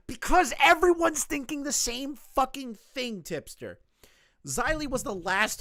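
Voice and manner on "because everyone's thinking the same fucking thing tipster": loud, high, slow and angry; exasperated retort. Real, not acted. Streamer answering Tipster's message, exasperated retort.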